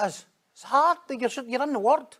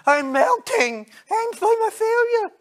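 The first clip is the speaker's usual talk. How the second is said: slow, much higher melodramatic wail; mock meltdown; 3 dB louder, slower than usual. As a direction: ham up the jealous pastor's collapse. Mocked jealous pastor.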